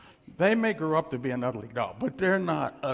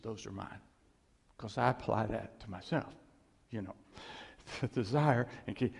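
usual speaking voice

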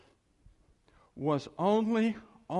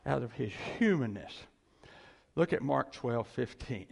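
usual speaking voice

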